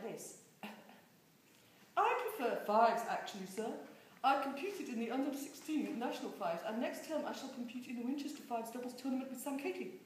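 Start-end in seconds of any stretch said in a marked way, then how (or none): 2.0-10.0 a lightly voiced prim schoolboy, pitch bobbing up and down; posh boy voice, up and down. A narrator's reading. Prim cousin making a speech to the adults, voice going up and down. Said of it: "his voice is going up and down".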